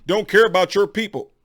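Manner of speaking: a lightly put-on voice of an accusing critic; mocking quote. To his critics, throwing back their own accusation mockingly. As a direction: parody the critic's reproach. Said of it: "when you say things to me like".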